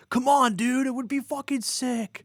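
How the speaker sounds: high pleading bro voice; begging teen voice. Pretend. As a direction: whine it like an eager teen. Imagined young Dugin begging Hitler.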